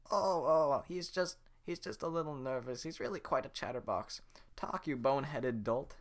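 fake-cheerful then snapping at the donkey; old woman covering for a silent donkey; flustered old woman.

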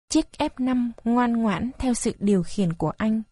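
ordinary delivery, nothing marked

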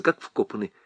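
plain reading voice

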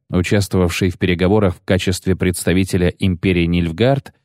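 ordinary delivery, nothing marked

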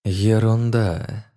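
slowly tasting "Ерунда", drawn out; savouring the word. Acted. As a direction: roll "Ерунда" slowly off the tongue. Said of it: "повторила Санти, пробуя слово на вкус".

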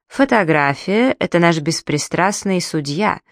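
plain reading voice

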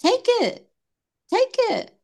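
high, intense plea to take it; urgent urging. Real, not acted. Urging listeners to embrace emotion.

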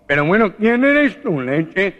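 high, loud, slow alarmed shout; the nasal man shouts at the unseen voice; startled nasal man. Acted. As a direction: yell it in a panic. Gangoso demands.

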